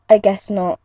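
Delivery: ordinary delivery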